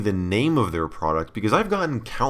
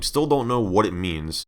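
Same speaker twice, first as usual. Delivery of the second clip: normal talking voice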